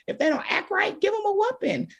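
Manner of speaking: high, mocking voice of old parenting advice; lightly hammed-up stern parent; mock old-school parent. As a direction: parody the traditional parenting rule. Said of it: "what life said do as a parent".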